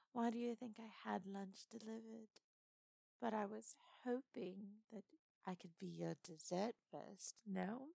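put-on flirtatious lightly done British accent. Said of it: "so I kinda wanted to try and do British".

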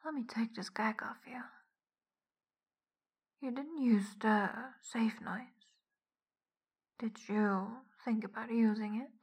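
ordinary talk, nothing marked

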